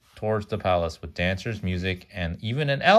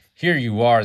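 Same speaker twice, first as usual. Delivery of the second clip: loud guards' shout, a touch higher; 5 semitones higher, 8 dB louder than usual. Acted. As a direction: call out like a guard catching a runaway. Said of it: "they shouted".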